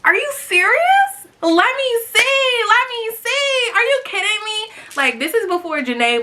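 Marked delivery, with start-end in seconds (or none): 0.0-4.7 excited disbelief. Retold or imitated. Mom hearing her daughter got retweeted, high thrilled squeal. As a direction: shriek it in delighted disbelief. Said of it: "I was like".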